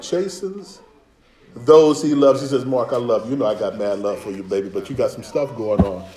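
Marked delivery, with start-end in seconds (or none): 2.7-6.0 low, soft loving reproof; tender correction. Acted. God lovingly correcting the one he loves, low and tender. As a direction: lower your voice and gently level with him.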